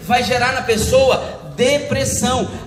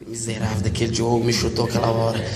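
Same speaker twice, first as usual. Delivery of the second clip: much lower, fast grumble under the breath; resentful grumble; 4 dB quieter, faster than usual. Retold or imitated. Man stewing over Diogo's kick at dinner, low resentful grumble. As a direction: growl the grudge low to yourself.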